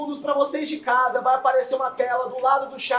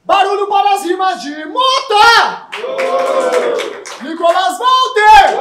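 hype shout; 6 semitones higher, 10 dB louder than usual. Real, not acted. Host asks crowd for noise.

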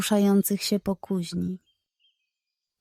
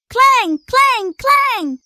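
loud, much higher clanking calls; metallic clank imitation; 14 semitones higher, 6 dB louder, much slower than usual. Mysterious noises in the forge at night, loud high clanks. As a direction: bang out each klęk like metal striking.

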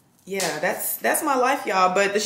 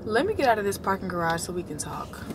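whisper; 4 dB quieter than usual. Real, not acted.

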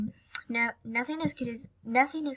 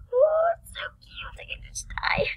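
squealing; 4 dB louder than usual. Real, not acted. Gushing over a cute otter.

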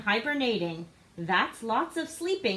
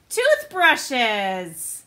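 excited reveal; 8 dB louder than usual. Acted. Reveal of toothbrush pajamas.

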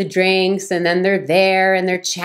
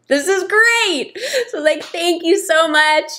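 much higher, slow airy delight with a laugh; delighted gushing; 12 semitones higher than usual. Retold or imitated. Woman thanking the builder, giddy and delighted. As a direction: float on the happy news. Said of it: "I float back into the house".

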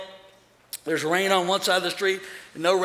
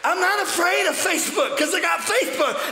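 higher, shouted preacher's cry; fiery preaching; 9 semitones higher than usual. Acted. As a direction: proclaim it without fear. Preaching at full force.

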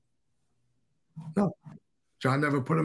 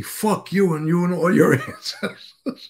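louder, higher imitation of the boss cursing, a laugh breaking in; retold fury, half laughing; mock angry blast; 5 semitones higher, 5 dB louder than usual. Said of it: "He got so mad".

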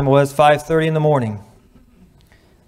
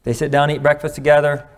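ordinary talk

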